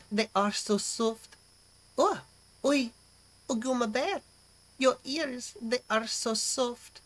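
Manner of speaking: attempted French maid, slipping toward Russian; slow, drawn-out mock French accent; sexy French-maid accent. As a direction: coo it in a badly faked French accent. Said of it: "say in a sexy French-made accent".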